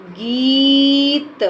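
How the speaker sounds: गीत drawn out very slowly; slow sounded-out word. Shown as a demonstration. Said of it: "बड़ी की मात्रा".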